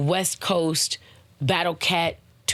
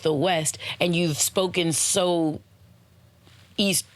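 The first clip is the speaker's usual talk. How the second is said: ordinary talk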